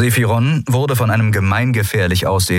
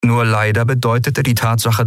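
ordinary talk, nothing marked

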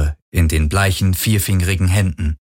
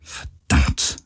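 low, whispered swearing; muttered curse; 7 semitones lower, 4 dB quieter than usual. A narrator's reading. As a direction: curse under your breath. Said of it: "murmelte sie". (leise vor sich hin gemurmelt)

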